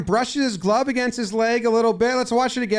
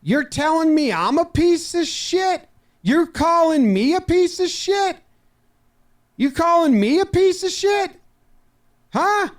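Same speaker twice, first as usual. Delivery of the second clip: rising furious yell; 8 semitones higher than usual. Acted. Manager screaming at the umpire about being called names, rising furious yell. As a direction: build from angry to screaming at the ump.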